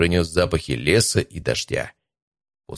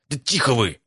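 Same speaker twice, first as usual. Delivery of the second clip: higher-pitched, urgent hush; exasperated shushing without whispering; 6 semitones higher than usual. A narrator's reading. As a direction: shut the bickering friends up. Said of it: "шикнул я на ребят".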